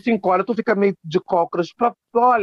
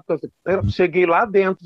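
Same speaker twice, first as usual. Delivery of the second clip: ordinary talk, nothing marked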